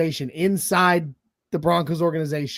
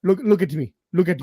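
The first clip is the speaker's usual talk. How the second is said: lightly voiced pirate captain; a hint of a menacing hijacker; Captain Phillips pirate; 2 semitones lower than usual. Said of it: "What's that guy from, uh, Captain Phillips?".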